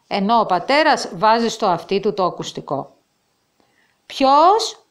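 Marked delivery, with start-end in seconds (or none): 4.1-4.8 a high, louder quavering question; trembling voice. A narrator's reading. Father lifts the receiver and asks with a trembling voice. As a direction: ask it with a tremble. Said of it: "με τρεμάμενη φωνή".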